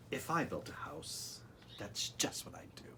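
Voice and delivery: soft, fading final line down to a whisper; whispered bedtime-story ending; soft storybook ending. Acted. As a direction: let the book's last line drift off into a whisper. Soft close of the book.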